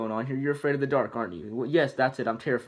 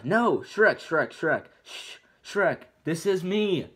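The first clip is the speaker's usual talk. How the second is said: frantic back and forth; 6 semitones higher, much slower than usual. Acted. Donkey shouting, Fiona hushing.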